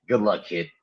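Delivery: low, mildly let-down send-off; disappointed. Acted. Chubbs wishing Happy luck, mildly disappointed. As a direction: wish him luck with a touch of disappointment. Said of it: "he was, like, very disappointed".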